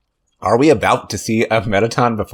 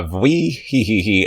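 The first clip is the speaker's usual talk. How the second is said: spider giggle; slower than usual. Acted. Player voices the spider queen's giggle. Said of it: "that's how spider sounds".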